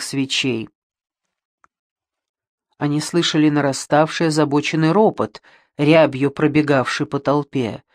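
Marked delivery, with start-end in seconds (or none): none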